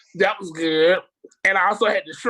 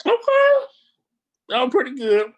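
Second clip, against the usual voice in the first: surprised; 5 semitones higher, 6 dB louder than usual. Retold or imitated. Retelling own reaction to food.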